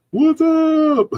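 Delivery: very high cooing voice; squeaky baby talk to a cat; baby talk greeting. Acted. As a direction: coo it high to a kitten. Greeting the kitten.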